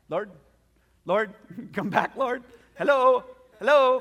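loud, high pleading calls into silence; calling out to God. Retold or imitated. Preacher calling out to a silent God, loud and pleading.

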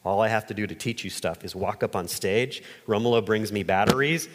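ordinary talk

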